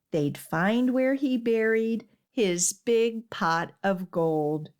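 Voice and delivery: ordinary delivery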